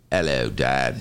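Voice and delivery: a hint of an English boy's greeting; lightly voiced British son; imitating British kid accent. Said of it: "Is he speaking with a British?".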